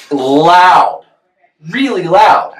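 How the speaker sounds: "Loud" stretched out, high and punched; exasperated high stress on "loud"; emphatic complaint. Real, not acted. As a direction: hit "loud" hard both times, pitched well up. Stressing how loud music was.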